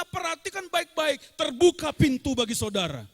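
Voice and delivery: loud, high fervent sermon; preaching at full force. Acted. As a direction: build and proclaim to the congregation. Preaching exhortation.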